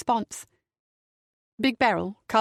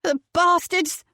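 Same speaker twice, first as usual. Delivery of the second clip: pained curse; 9 semitones higher, slower than usual. A narrator's reading. Beryl cursing his attackers in pain.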